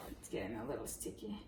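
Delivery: soft whispered aside; whispering. Real, not acted. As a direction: whisper it to yourself. Aside while kneading dough.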